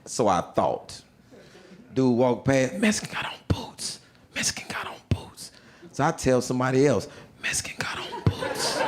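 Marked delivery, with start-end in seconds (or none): 2.7-5.5 hushed, secretive stage whisper; retold whisper. Inmate passing on a warning as he walks past, whispered. 7.4-8.8 hushed stage whisper, no voice; retold whisper. New inmate telling somebody else the warning, whispered.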